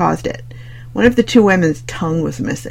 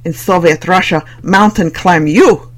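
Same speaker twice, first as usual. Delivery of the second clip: hammed-up bad Russian accent, slow punchline; poor Russian accent; 5 dB louder than usual. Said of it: "a deliberately poor Russian accent joked".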